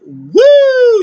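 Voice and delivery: long, high, loud whoop; re-enacted high whoop of shock; whooping reaction. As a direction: let out a drawn-out "woo" at a sick burn. Own reaction to a harsh put-down.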